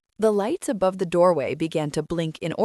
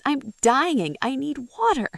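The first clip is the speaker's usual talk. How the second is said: high, pleading groan, lightly acted; 7 semitones higher than usual. A narrator's reading. Dying traveler begs the old man for water, groaning. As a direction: plead desperately in a raised pitch. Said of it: "the traveler groaned".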